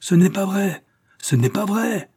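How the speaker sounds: high, loud denial; desperate shout. A narrator's reading. The father to his daughter, high desperate shout. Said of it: "cria le père".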